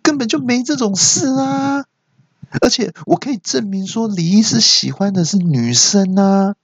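very high, stretched mock screech; imitated indignant cry. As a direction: protest like the outraged nurse, up in head voice. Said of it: "那护士就大叫说". (学护士尖声抗议大叫)

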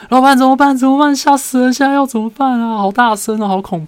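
high, loud replayed panic, fading out at the end; panicked yell. Coworker yelling to the boss during thunder, panicked high shout. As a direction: re-enact shrieking at the boss in fright. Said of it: "我就在我的位置上大叫说".

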